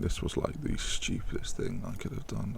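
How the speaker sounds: whispered under his breath; a barely voiced whisper of regret; whispered despair. Real, not acted. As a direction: mutter it to yourself in dismay.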